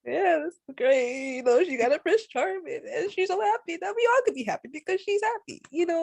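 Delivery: high, gushing delight over the happy ending. Retold or imitated. Friend gushing to friends about Cinderella's ending, high and delighted. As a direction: squeal it like a fairytale ending just made your day.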